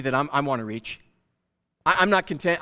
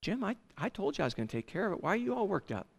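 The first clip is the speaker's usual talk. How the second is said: God's gentle reply; 3 semitones higher, 11 dB quieter, faster than usual. Acted. God answers Jim in dialogue.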